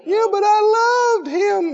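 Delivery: hammed-up sob of a lovesick teen; mock tearful teen. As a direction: plays the weeping heartbroken kid. Voicing heartbroken teen.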